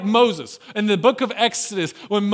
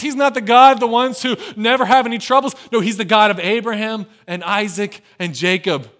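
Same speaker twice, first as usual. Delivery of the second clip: usual speaking voice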